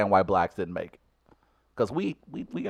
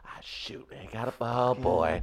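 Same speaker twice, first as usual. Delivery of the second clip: hushed groan; 5 semitones lower, 11 dB quieter, slower than usual. Retold or imitated.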